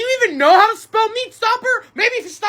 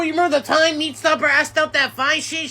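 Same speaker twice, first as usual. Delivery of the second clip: ordinary delivery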